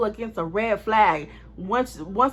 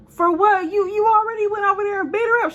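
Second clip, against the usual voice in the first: shocked disbelief; 11 semitones higher, 6 dB louder than usual. Retold or imitated. Shocked at friend's plan.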